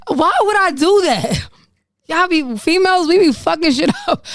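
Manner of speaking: high, laughing-at-yourself disbelief; high-pitched exasperation. Real, not acted. Laughing at her own past snooping.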